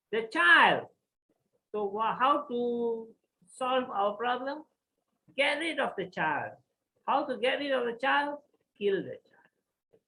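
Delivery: dramatic rhetorical questions and answers; emphatic plotting. Acted. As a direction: spell out the evil plan with force. The wives plot the child's death.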